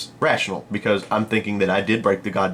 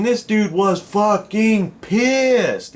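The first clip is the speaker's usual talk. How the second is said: "fucking pissed" drawn out slowly at a higher pitch; emphatic; 11 semitones higher, much slower than usual. Real, not acted. Storyteller stressing how mad the caller was, "fucking pissed" slow and emphatic. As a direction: stretch out each word of "fucking pissed".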